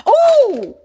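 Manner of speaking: high, loud ooh; excited ooh. Real, not acted. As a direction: squeal at the find. Spots new snack.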